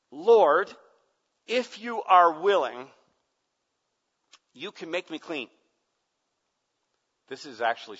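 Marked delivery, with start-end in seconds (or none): 0.1-5.5 loud, high pleading cry to the Lord; loud desperate begging; leper's plea. Pretend. Leper's plea, voiced loudly.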